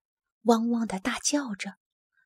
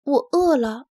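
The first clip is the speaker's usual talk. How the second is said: a sudden louder, higher "I'm hungry"; little dog suddenly speaks up, raised voice; 4 semitones higher, 5 dB louder than usual. Acted. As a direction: blurt it out loudly after whispering. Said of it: "然后又大声地补充了一句".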